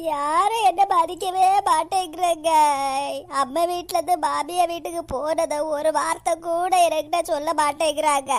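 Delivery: wailing lament. Acted. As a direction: lament it like a hammed-up sob story. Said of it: "என்னடி இப்படி புலம்பிட்டு கிடக்க?". (புலம்பி அழும் குரல்)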